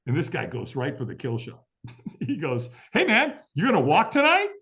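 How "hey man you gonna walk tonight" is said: high, loud, punchy pitch to a stranger; eager invitation. Retold or imitated. Stranger inviting him to walk on fire, eager. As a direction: call it out brightly as a cheery recruiter.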